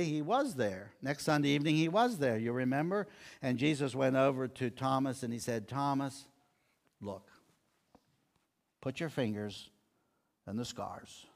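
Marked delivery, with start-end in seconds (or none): 5.7-11.3 very soft, low, with long pauses; quietly and patiently invite a doubter to look; gentle, hushed invitation. Retold or imitated. Jesus to doubting Thomas, inviting proof, hushed and gentle.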